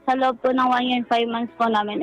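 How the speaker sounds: ordinary talk, nothing marked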